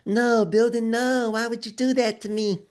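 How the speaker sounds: mock whining Carrie. Teasing Carrie about her mistake, mock wailing. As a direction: wail like a student who got caught out. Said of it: "Carrie was the one up here talking about".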